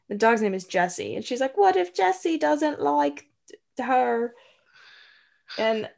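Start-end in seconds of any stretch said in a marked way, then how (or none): 1.6-4.3 put-on high child voice, Scottish accent; little girl, Scottish accent. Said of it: "your child Scottish accent".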